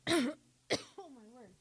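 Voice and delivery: ordinary talk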